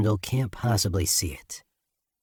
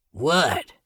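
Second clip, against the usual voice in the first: rough gruff man; 5 semitones higher than usual. Acted. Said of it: "Rough as a bag of rocks".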